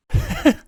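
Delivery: high, bright burst of laughter; fond father laughing at his daughter. A narrator's reading. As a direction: let out a fond delighted laugh. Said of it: "He laughed".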